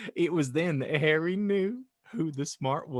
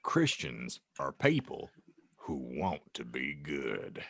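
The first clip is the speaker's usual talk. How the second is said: much lower, soft gentle giant; wise Hagrid; 13 semitones lower, 6 dB quieter than usual. Acted. Hagrid teaching Harry, low soft voice. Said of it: "Hagrid explained wisely".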